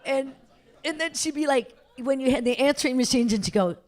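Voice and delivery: ordinary delivery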